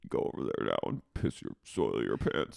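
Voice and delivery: low, hushed villainess order; mock sinister command. Katie controlling his body, low mock menace. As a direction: imitate a mind-controller, pitched far down.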